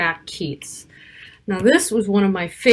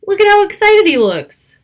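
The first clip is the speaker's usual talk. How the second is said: much higher, louder delighted aside; excited aside; 12 semitones higher, 9 dB louder than usual. Acted. Reader showing children the happy picture, excited. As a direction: share his excitement with the kids.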